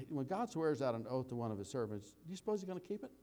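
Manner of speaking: ordinary delivery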